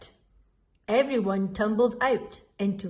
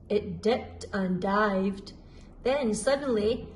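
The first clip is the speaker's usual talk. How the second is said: ordinary delivery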